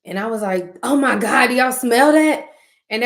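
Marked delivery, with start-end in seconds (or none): 0.8-2.4 raised, loud alarm at a smell. Retold or imitated. To her family about the pizza, loud and alarmed.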